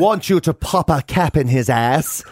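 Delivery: put-on low, slow gangster in a phoney Dublin accent; badly faked Dublin accent. Soap gangster ordering a killing, badly faked Dublin accent. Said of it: "What do you think of my Dublin accent".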